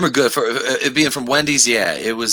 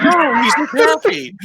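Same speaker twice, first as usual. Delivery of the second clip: mock reassuring boyfriend; 13 semitones higher, 5 dB louder than usual. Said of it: "He's like".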